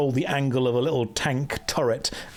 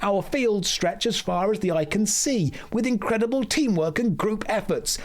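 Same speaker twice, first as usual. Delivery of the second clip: higher, proud storytelling shopkeeper; higher, hammed-up NPC merchant voice; Kadikarus impression; 5 semitones higher than usual. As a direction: boast about the city like a cheerful merchant. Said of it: "be doing a Kadikarus impression".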